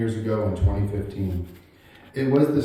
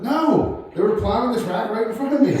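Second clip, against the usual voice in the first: much higher, laughing through granddad's answer; 11 semitones higher, 3 dB louder than usual. Real, not acted. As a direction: squeak it out between laughs.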